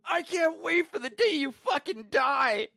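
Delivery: hammed-up hateful line, stretched out; mock shrill coworker. Mocking the coworker who storms off, high and slow. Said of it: "she's like".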